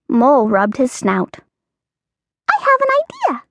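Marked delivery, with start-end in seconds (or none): 2.5-3.4 very high squeaky voice; squeaky mouse. Acted. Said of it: "squeaked Mouse".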